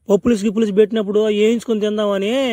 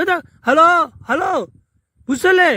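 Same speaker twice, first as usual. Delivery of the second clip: calling out on phone; 7 semitones higher than usual. Acted. Shouting into a bad phone line.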